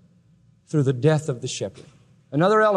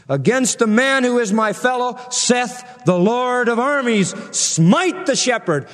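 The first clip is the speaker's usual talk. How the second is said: higher, slow and measured reading; scripture proclaimed; 7 semitones higher than usual. Acted. Preacher rereading God's call to the sword, slow and lifted. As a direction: lift it like a solemn decree.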